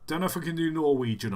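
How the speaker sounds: usual speaking voice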